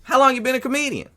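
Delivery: high mocking impression of a comic roasting a heckler; mocking sarcasm. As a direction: needle her with exaggerated scorn. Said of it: "he goes".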